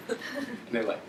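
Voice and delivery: ordinary talk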